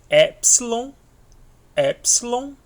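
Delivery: Epsilon said high and loud, stressed on the first syllable as /ɛpslo/; pronunciation demo. Shown as a demonstration. Teacher drilling the Greek letter Epsilon, high and loud. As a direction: call out Epsilon high and loud, rising at the end.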